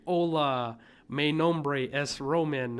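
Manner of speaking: mock a clumsy English-speaker's accent in Spanish.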